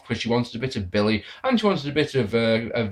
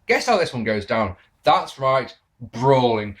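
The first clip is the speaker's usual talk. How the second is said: normal talking voice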